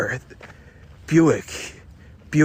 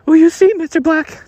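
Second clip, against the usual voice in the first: falsetto damsel; 11 dB louder than usual. Acted. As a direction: beg the detective in a high falsetto. Woman voice.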